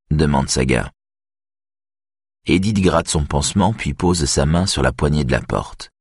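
usual speaking voice

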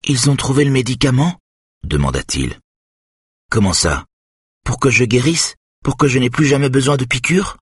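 put-on a sick little boy hoping for a cure; hopeful young boy. A narrator's reading. Son asking father about the medicine, hopeful and childlike.

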